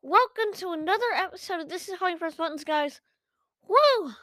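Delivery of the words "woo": cheer. Acted. Excited cheer in intro.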